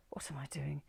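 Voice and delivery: soft unvoiced self-question; whispered to herself in the taxi. A narrator's reading. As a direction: breathe it to yourself in disbelief.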